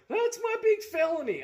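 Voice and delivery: mock the idea of a felony, loud; loud high sneer at the accusation; sarcastic. Real, not acted. Sarcasm about his charge.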